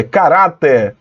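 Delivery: "caráter" said slowly with the weight on middle RA; drawn-out middle syllable in "caráter"; stress on RA. Shown as a demonstration. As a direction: correct it to ca-RÁ-ter, leaning on RA. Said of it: "a sílaba tônica é ra".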